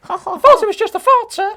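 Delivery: high, loud quoted outburst; comic put-on voice, pitched right up; sitcom impression. Quoting In-Betweeners comedy scene together.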